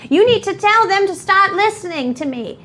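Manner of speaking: put-on high, upset old woman; old lady voice. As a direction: plead like a distressed old lady. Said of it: "just looks at you and goes".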